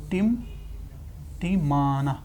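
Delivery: very slow, soft, stretched-out word; softly drawn-out teacher model. Shown as a demonstration. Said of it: "teacher models word slowly".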